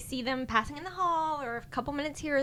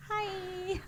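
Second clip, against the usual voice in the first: sheepish hi; 9 semitones higher, 6 dB quieter than usual. Retold or imitated. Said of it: "And I'm like".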